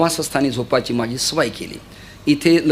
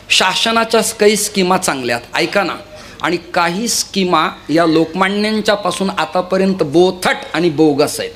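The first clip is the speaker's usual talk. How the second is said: ordinary delivery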